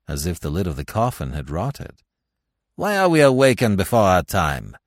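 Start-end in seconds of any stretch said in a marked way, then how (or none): 2.7-4.8 very loud, higher, bitter demand; harsh cry. A narrator's reading. Dead man demands answers from his coffin, harsh and loud. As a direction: hurl the question out harshly. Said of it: "cried Francois harshly".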